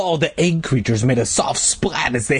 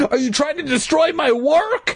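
very high, rising outraged question; frenzied rage; 14 semitones higher than usual. A narrator's reading. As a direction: peak the rant in a high cry. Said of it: "his face red with anger".

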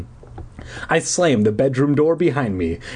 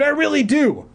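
screaming. A narrator's reading. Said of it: "I screamed".

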